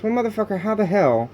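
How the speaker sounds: high-pitched angry burst, then cut short; snapping in real frustration; angry outburst. Real, not acted. Venting to the camera about being told to work, sudden angry burst.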